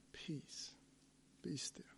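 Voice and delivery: hushed, almost no voice; whispered echo. Acted. Preacher whispering Jesus's words to the storm, whispered. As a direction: breathe it in a whisper.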